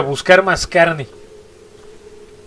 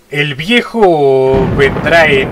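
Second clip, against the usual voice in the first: slow, drawn-out, louder read-out; ominous message reading; 5 dB louder, much slower than usual. Acted. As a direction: read it out like a slow, heavy prophecy. Lee en voz alta el mensaje. (lectura lenta y solemne de una profecía)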